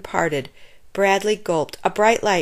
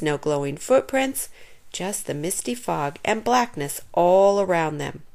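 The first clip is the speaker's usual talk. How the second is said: ordinary delivery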